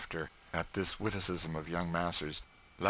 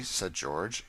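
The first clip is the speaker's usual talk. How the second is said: usual speaking voice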